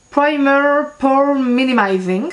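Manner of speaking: slow, hammed-up English accent on the product name; mock English accent. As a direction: read the name like a proud but shaky English speaker. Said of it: "avec mon superbe accent anglais".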